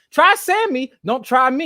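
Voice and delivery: high, loud warning; loud tagline warning; loud punchline. Acted. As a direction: snap it out like a threat.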